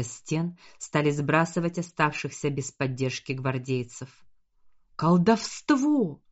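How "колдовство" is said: high, slow anguished cry; man down shout. A narrator's reading. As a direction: cry out as the wounded. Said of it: "кричали раненые".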